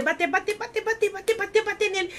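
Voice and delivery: high-pitched, climbing run of repeats; relentless repetition. Retold or imitated. Narrator acts out Pry's nonstop beating, high repetition. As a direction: repeat the word over and over in a high sing-song.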